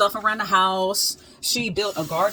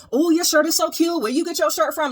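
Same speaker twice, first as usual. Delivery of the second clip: higher, louder, bubbly gush over a cute shirt; mock gushing compliment; 10 semitones higher, 3 dB louder than usual. Imitating a shopper gushing over her shirt, higher and louder. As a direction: gush like an impressed stranger. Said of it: "I'm expecting, like, reactions like that".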